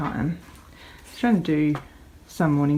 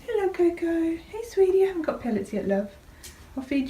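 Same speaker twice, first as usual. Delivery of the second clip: much higher, sing-song pet voice; pet talk; 12 semitones higher than usual. Real, not acted. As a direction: coo it up high to a rabbit. Greeting the rabbit.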